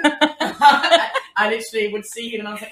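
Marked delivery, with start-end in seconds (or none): 0.0-1.1 slow, loud laughing through words; laughing hard. Real, not acted. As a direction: crack up mid-sentence. Laughing mid-sentence.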